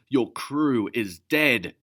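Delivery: loud and slow, landing hard on dead; furious shout; angry shout. Acted. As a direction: slam the truth at her. Elvin continues his snarl.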